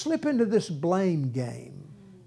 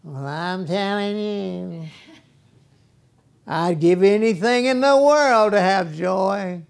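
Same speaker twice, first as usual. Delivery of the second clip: whiny voice; 3 dB louder than usual. Retold or imitated. Preacher mimics a whiny churchgoer, whining. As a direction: whine it like a self-pitying complainer. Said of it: "get into this whiny thing".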